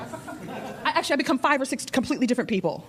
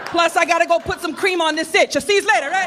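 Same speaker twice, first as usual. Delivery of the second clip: put-on brash Jersey girl accent; Jersey pageant contestant; New Jersey accent. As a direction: talk tough like a Jersey girl. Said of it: "I'm Miss New Jersey".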